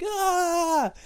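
very high strained ah; boy straining to move the volume; straining kid cry. Retold or imitated. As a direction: squeak like a child focusing hard.

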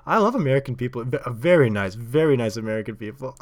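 gushing imitation of a Polish accent; excited Polish accent. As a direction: gush like a delighted Polish visitor. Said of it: "I'm pretty sure it was Polish accent".

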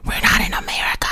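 hushed mock whisper; whispered realization. Realizing the book is set in England, whispered. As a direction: breathe it out in a whisper, amused. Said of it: "I'm like".

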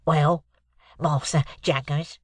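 slow, congested client voice; stuffed-up cold. Acted. Client Mike answers the lawyer, bunged up with cold. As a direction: talk through a heavy head cold. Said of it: "voice of a sufferer from a constitutional cold".

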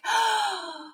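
high gasp of amazement; retold gasp. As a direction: act out an astonished intake of breath. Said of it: "And I'm like".

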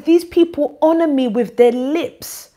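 preaching the verse out loud, slowing. Acted. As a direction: build it up like a sermon. Reading scripture with rising force.

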